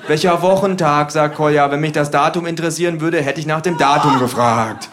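losing patience with the flatmates; irritated loud flatmate; Kolja exasperated. Retold or imitated.

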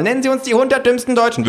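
high, fast demand; high-pitched rapid interrogation; hounding sociologist. Acted. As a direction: rattle it off in a high pollster voice. Sociologists joining the chase.

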